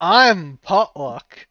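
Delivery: slow, drawn-out grand self-introduction; Potluck. Acted. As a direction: stretch the name out proudly. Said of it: "I say as I walk off".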